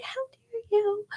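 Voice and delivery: very high, slow indignant squeak; mock a pouty offended commenter; mock outrage. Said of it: "they're gonna be like".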